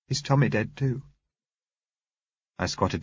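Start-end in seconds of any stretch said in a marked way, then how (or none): 0.1-1.0 put-on high, small child's question; sad little girl. A narrator's reading. Girl with fresh tears asks about Tommy, high and sad. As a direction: ask in a young girl's high voice.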